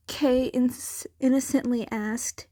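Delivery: plain reading voice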